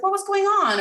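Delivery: high-pitched open question; gentle curiosity. Acted. Parent asks child an open-ended question, gently curious. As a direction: ask it lightly, without blame.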